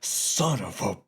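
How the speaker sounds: a hint of Farley drawing it out; lightly voiced angry Farley, slowed; Chris Farley imitation. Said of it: "he goes".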